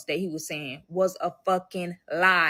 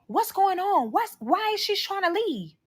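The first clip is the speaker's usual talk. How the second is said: high-pitched alarmed questioning; agitated mom voice, pitched up; hostile mother; 12 semitones higher than usual. Retold or imitated. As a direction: imitate his mother demanding to know. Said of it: "she's very hostile".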